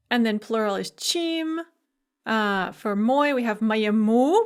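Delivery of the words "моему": "моему" stressed on the last syllable, ma-ye-MU; stress on final u. Shown as a demonstration. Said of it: "with the stress on the u".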